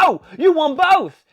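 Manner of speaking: loud, high, drawn-out imitated amazement; mock amazement. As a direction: exclaim it big, imitating an astonished wrestler. Voicing R-Truth's amazed reaction.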